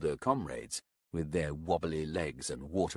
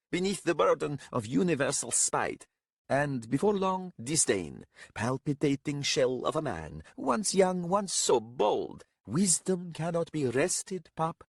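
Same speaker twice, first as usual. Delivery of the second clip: high, declaiming mock lament; mocking old companion; 9 semitones higher than usual. A narrator's reading. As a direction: mourn his lost youth in theatrical verse.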